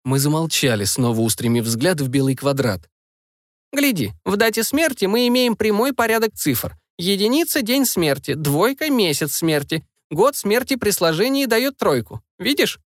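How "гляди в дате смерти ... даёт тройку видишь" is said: lightly voiced grandmother, raised pitch; a hint of a wise old witch; old woman voice. Grandmother explains the anagram.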